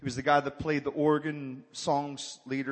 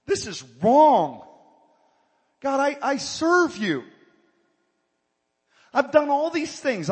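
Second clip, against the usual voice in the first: higher, louder complaining prayer voice; whining to God; 7 semitones higher, 5 dB louder than usual. Retold or imitated. Believer whining to God about his good deeds, high and loud. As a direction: whine at God, listing your good deeds. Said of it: "You ever cry like that to God".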